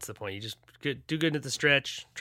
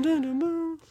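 mock effect; slower than usual. Joking sound effect.

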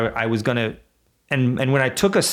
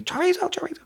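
put-on much higher, a little girl's shrill call of Chorizo; child screaming the dog's name; 3 dB quieter, faster than usual. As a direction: imitate a small daughter yelling Chorizo at the dog. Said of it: "my four-year-old daughter now will be screaming".

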